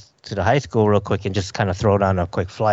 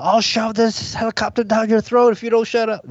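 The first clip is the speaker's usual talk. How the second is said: mock threat; 12 semitones higher than usual. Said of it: "he'll turn around and be like".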